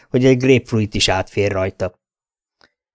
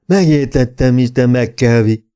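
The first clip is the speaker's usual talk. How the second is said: slow, dull, a little louder; a lumbering brute reciting the obvious; slow dim thug voice; 4 dB louder than usual. A narrator's reading. Thug Tommy explains to the detective he obeys McKelvey, slow and dull.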